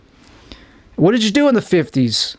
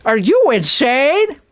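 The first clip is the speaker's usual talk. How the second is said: mock pitchman; 9 semitones higher, slower than usual. Mocking a commercial pitch.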